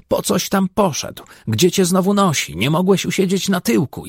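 high, nagging wife's voice; high mocking imitation of a scolding wife.

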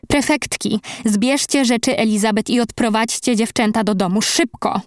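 ordinary delivery

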